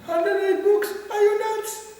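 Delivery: high, loud, sing-song disbelief; mock them gasping at the number; incredulous. Devotees doubting his book count, high, loud sing-song disbelief. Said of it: "they're like".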